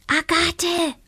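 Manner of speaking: high tiny voice calling; a small witch's high call; little voice. A narrator's reading. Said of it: "ertönte ein Stimmchen".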